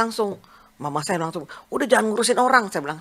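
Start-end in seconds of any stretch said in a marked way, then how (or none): 1.7-2.7 higher, louder retold reproach; objecting mother. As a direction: voice a mother telling someone off, raised pitch. Mother objects to her helping.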